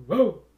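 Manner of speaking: loud, high, short dog-like bark; playful loud bark; mock dog bark. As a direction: imitate a dog's sudden woof. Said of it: "Pareceu um latido de cachorro".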